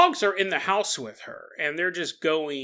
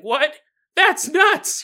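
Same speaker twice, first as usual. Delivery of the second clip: high, loud mock shock; shocked squirrel; 10 semitones higher, 7 dB louder, much slower than usual. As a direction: squeal it like a stunned squirrel. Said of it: "You'd be like".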